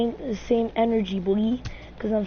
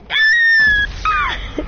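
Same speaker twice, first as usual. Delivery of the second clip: high, loud scream of alarm; panicked scream; 8 dB louder than usual. Real, not acted. Gamer caught in the game, loud scream. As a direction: shriek as you get caught.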